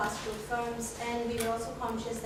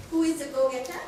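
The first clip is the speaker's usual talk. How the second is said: louder, joking jab through laughter; playful dig at the colleague; teasing laugh; 9 dB louder than usual. Real, not acted. Teasing colleague about overrunning.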